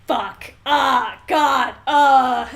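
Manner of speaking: hammed-up loud dying groans; mock dying groans. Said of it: "And he's like".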